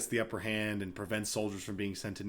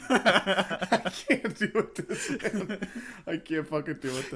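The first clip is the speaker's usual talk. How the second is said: laughing disbelief; 7 semitones higher than usual. Retold or imitated. Exasperated Fitz quote, laughing.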